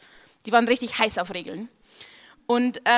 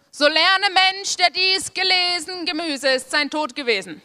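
solemn moral; 6 semitones higher than usual. Acted.

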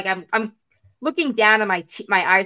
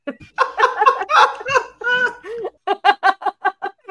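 loud high laugh; 13 semitones higher, 7 dB louder than usual. Real, not acted. Laughing at the story.

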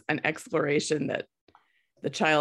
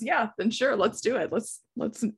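sarcastic; 4 semitones higher, 3 dB quieter than usual. Real, not acted. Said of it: "That was sarcasm".

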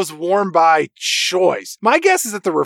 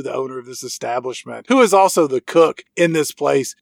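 ordinary delivery

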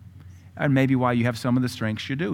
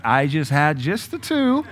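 Peter, laughing; 4 semitones higher, 4 dB louder, slower than usual. Retold or imitated. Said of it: "He's like".

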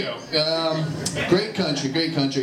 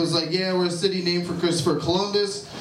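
usual speaking voice

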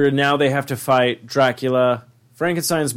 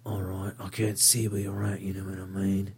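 hushed action hero; 5 semitones lower, 12 dB quieter than usual. Retold or imitated. Acting a blind fighter's line.

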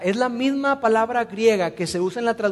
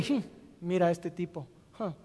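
snooty judgment; 3 semitones lower, 8 dB quieter than usual. Retold or imitated. Judging someone, imitated inner voice.

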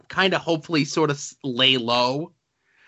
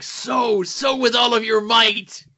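dramatic mentor voice; 7 semitones higher, slower than usual. Pretend. Parody of Three Amigos line.

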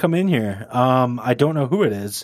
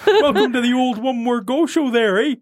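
higher, caricature Canadian show opener; 7 semitones higher than usual. Retold or imitated. Host parodying his old show welcome, exaggerated Canadian. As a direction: ham up a folksy Canadian welcome. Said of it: "used to say it very Canadian".

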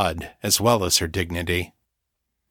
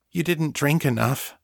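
higher, mildly worried voice; a touch of worry, pitched up; 6 semitones higher than usual. A narrator's reading. Thrall concerned for her starving mistress, mildly worried. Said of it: "sounding worried".

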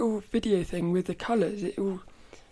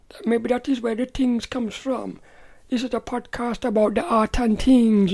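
light put-on Indian accent; mild mock Indian accent, growing louder; 6 dB louder than usual. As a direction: playfully slip into an Indian accent. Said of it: "you're just doing an accent".

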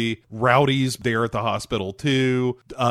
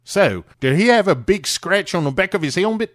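higher, louder mock Australian accent; Paul Hogan Australian; 7 semitones higher, 4 dB louder than usual. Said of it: "Just do your Paul Hogan".

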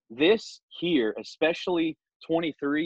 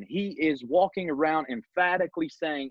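usual speaking voice

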